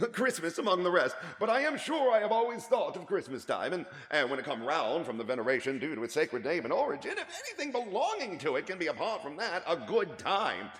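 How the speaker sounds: high, loud earnest laughing plea; heartfelt speech. Acted. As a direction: praise Christmas with warm conviction. Nephew's warm Christmas speech.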